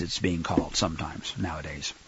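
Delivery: very low, near-whispered tail; hushed aside. Real, not acted. Preacher commenting on a modern term, hushed aside. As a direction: drop right down to an undertone.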